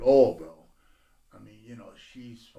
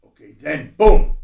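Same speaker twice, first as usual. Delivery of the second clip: ordinary talk, nothing marked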